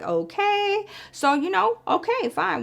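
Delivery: eyeing his ringing phone, suspicious; sceptical okay. Retold or imitated. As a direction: let the okay climb high with suspicion. Reacting to ignored phone call.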